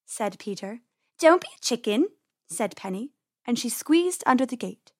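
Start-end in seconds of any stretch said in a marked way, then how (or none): 1.2-2.1 teasing dare. A narrator's reading. Penny goads her timid brother.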